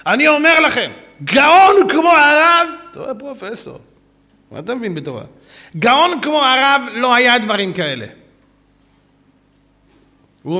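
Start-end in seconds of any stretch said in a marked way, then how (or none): 0.1-2.7 high, loud, slow declaration; professor's emphatic praise. Retold or imitated. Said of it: "אתם יודעים מה הוא אומר". 5.8-8.1 professor's praise. Retold or imitated. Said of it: "אתם יודעים מה הוא אומר".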